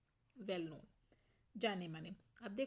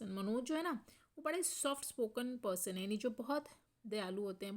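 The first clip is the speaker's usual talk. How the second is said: ordinary talk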